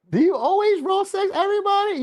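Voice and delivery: accusing lover. Retold or imitated. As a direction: grill the cheating partner in a high, scandalised voice. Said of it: "you can be like".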